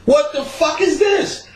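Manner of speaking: high, drawn-out shocked question. Retold or imitated. Shabazz walking in on the scene, high shocked question. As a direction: stretch it out in disbelief. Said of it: "Shabazz came in and said".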